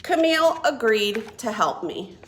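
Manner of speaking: plain reading voice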